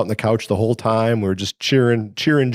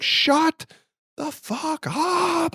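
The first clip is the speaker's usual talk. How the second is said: much higher, strained, stretched-out angry yell; mock furious yell; 4 dB quieter, much slower than usual. Owner losing it at his bellowing old cat, high strained yell. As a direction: lose it at the cat, high and slow. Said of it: "I just lose it".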